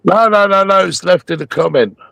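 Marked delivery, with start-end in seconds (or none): none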